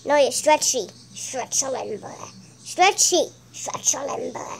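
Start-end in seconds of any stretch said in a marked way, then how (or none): 1.1-2.1 quiet character voice. Pretend. Whispery character insists on name. 3.5-4.5 hushed, whispered correction; quiet whispering character; whispery character voice. Pretend. Whispery character insists on name.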